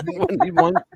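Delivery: high-pitched, through real laughter; words squeezed out high through a laugh. Real, not acted. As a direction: repeat the jab while cracking up. Laughs at own jab, repeats it.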